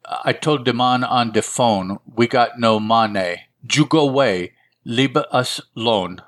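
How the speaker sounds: put-on rough, anglicized Central or South American accent; broken-English Latin American accent. Woman tells collector to go away, broken Latin American accent. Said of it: "a broken English of an, another Central or South American accent".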